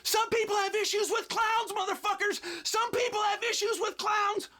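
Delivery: high mock yell, strained; Peter Berg yelling. Director chasing the masked man into the desert, high mock yell. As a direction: holler it like an angry director chasing a clown. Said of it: "yelling at me".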